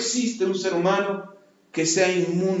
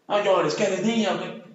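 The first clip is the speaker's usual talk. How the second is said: taunting adult; 3 dB quieter than usual. Retold or imitated. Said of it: "Eso era lo que me decían a mí".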